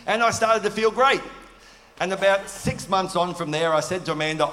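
ordinary delivery

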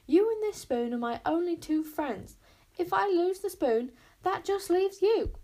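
loud, high, emotional insistence; urgent high-pitched appeal; impassioned plea. A narrator's reading. Wish pleads to keep her friend.

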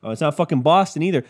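ordinary talk

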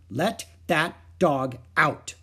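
slow, loud, firm mother's order; stern mother. A narrator's reading. Mother ordering her little girl to free the dog, stern. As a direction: lay down the law slowly. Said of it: "Mother's voice was stern".

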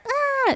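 high, sustained "ah" at normal volume; mock toddler scream. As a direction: imitate a screaming toddler without really yelling. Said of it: "just imitating her".

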